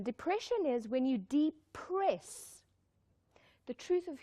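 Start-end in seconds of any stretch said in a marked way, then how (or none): 1.2-2.6 "de-press" stretched into two slow beats; depress split in two. Shown as a demonstration. Speaker defines depression for the audience, depress split slowly in two. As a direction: break depress apart, de... press.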